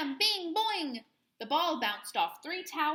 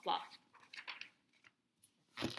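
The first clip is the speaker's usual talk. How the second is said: usual speaking voice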